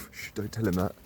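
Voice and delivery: hushed, sharing a secret; conspiratorial hush. Real, not acted. Secret plan against his mate.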